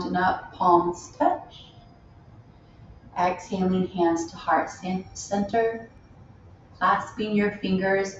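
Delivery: unhurried meditation guide with long pauses; spacious, measured yoga-teacher delivery; guided meditation. Real, not acted. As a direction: lead them gently inward, phrase by phrase. Leads a loving kindness meditation.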